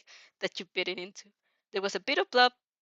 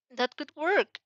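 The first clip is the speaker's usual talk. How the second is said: higher; 6 semitones higher, 3 dB louder, slower than usual. Shown as a demonstration.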